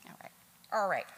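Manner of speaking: usual speaking voice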